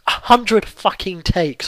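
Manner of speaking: slow, high, stressed; exasperated. Real, not acted. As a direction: stretch each word in disbelief. Exasperated emphasis.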